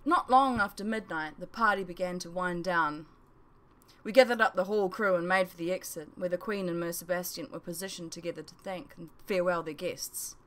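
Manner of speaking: ordinary delivery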